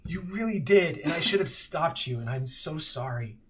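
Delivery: put-on a tearful old friend finally apologising; Bookie, laughing relief. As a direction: burst out with it, half laughing, half sorry. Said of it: "Bookie just kind of laughs and says".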